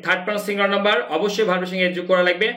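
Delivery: ordinary talk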